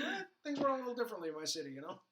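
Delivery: offhand Flash. Retold or imitated. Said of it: "He goes".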